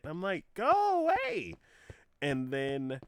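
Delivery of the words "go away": much higher and louder, slow; exasperated whine. Retold or imitated. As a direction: shoo it off in mock exasperation. Fed up with promo emails.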